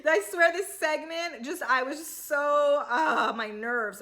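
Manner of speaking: slow, exasperated groan of frustration; fed up and worn out, slow; real exasperation. Real, not acted. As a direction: let the annoyance drag out slowly. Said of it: "you know I'm irritated when I curse".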